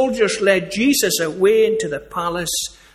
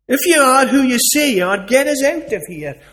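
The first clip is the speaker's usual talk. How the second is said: louder, higher jeering taunt; mocking thief; 6 semitones higher, 6 dB louder than usual. Thief on the cross taunting Jesus, loud jeer.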